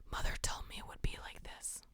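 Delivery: hushed creepy whisper into the mic. Pretend. Streamer reading a creepy chat line, whispered. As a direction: breathe it like a stalker in your ear. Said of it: "whispering something creepy".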